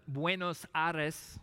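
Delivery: Buenos Aires said with a Spanish accent, as /wẽnos ɑɾəs/. Shown as a demonstration. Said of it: "You like my Spanish accent there?".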